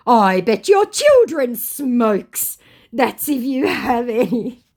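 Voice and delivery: slow, high, loud mock voice; mocked scammer. Scammer taunts her about children, mocking. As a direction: lay on the scammer's jibe mockingly.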